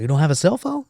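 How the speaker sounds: high rising incredulous question; retold disbelief. Marine doubting the reporter's denial, retold disbelief. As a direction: question it with mild disbelief, lifting at the end.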